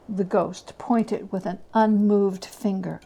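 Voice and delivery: ordinary delivery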